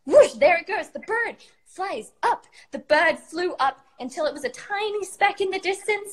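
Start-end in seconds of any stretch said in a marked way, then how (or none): none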